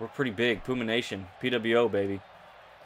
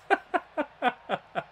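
a burst of high laughter; real laugh at own joke; 14 semitones higher than usual. Real, not acted. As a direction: crack up at your own line. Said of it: "I make myself laugh".

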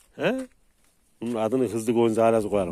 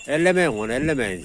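calling out; 4 semitones higher, 5 dB louder than usual. Real, not acted. Telling others not to touch.

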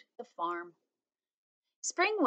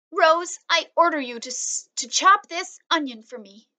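high, louder playful command; girl with onion tears giving orders; bossy little girl; 7 semitones higher, 7 dB louder than usual. A narrator's reading. Teary girl orders robot.

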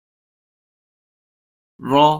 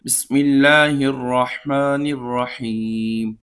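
louder Quranic recitation, each word set out for pupils to repeat; read-along recitation; 3 dB louder than usual. Shown as a demonstration. Teacher modelling Bismillah for pupils to recite along, louder recitation. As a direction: recite it as a model for the class to copy. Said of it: "آپ بھی اس کو ساتھ ساتھ مل کر پڑھ سکیں".

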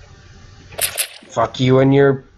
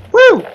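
high, loud, short "woo"; excited whoop; 6 dB louder than usual. Real, not acted. Player whooping on a risky ride, excited whoop. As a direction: whoop at the thrill. Thrilled by a game stunt.

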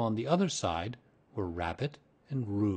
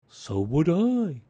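slow, higher, louder bear voice; wistful bear; 7 semitones higher, 7 dB louder, slower than usual. A narrator's reading. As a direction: agree with drawn-out longing. Pooh wistfully agrees with Piglet.

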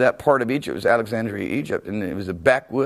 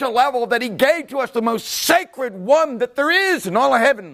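high, slow, rising preaching; full-force preaching; 10 semitones higher than usual. Real, not acted. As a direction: preach it out with fervor.

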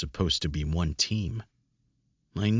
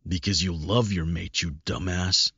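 ordinary delivery, nothing marked

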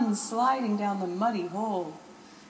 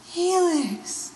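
much higher, louder startled cry; surprised gasp; 10 semitones higher, 7 dB louder than usual. Acted. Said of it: "Garnet gasped".

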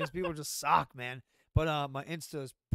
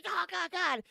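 mock alarm; 14 semitones higher, 4 dB louder than usual.

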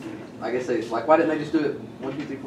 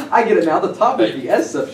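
louder, laughing I get it now; excited realization; 3 semitones higher, 6 dB louder than usual. Real, not acted. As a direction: blurt it out delighted. Student laughs, realizes aloud.

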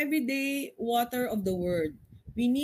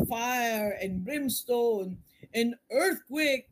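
slow, drawn-out hellfire preacher parody; mock fire-and-brimstone preacher; much slower than usual. Preacher mocking expectations of dramatic signs, slow mock hellfire. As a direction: drag it out like a doom preacher. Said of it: "Kailangan may".

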